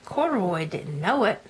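ordinary delivery, nothing marked

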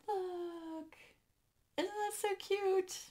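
high, delighted squeal of a voice; gushing over cuteness. Real, not acted. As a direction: coo over something adorable. Gushing over a cute tray.

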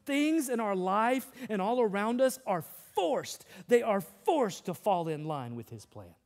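loud, high, driving sermon voice; full-force preaching. Acted. Pastor to the congregation, preaching at full force. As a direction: lift the whole room.

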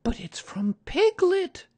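put-on very high, loud, slow child's cry; surprised cry. A narrator's reading. Christopher Robin reads Piglet's message, high startled cry. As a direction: cry out in astonishment as a small boy. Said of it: "cried Christopher Robin when he had read it".

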